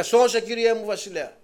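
desperate woman crying out to the king; woman's plea. Acted. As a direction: beg the king for help.

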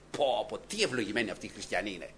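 normal talking voice

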